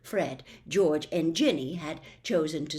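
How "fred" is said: usual speaking voice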